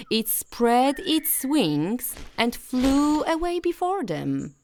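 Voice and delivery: ordinary delivery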